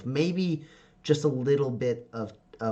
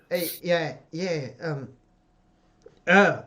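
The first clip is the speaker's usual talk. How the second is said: mock flustered, halting filler words; awkward stammer; 4 semitones higher, 3 dB louder, slower than usual. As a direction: fumble through an unwanted hug. Awkward hug, character flustered.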